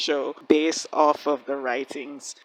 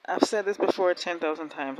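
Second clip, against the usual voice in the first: normal talking voice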